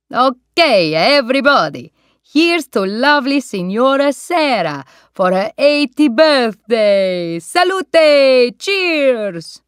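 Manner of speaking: put-on loud, festive Italian-accented toast. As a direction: raise the glass to the birthday lady.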